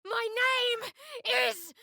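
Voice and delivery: high, slow, ringing war cry; defiant shout. Acted. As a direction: proclaim her name at the enemy. Defiant declaration in battle.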